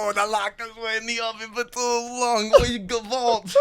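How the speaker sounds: high, hammed-up Yiddish accent. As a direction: camp Yiddish bubbe voice. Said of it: "she's like".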